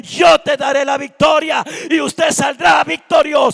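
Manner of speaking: loud, rousing sermon climax; full-force preaching. Real, not acted. Preacher promising the congregation God's victory, full-force preaching. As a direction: proclaim victory at the top of your voice.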